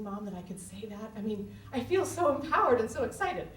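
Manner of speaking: ordinary talk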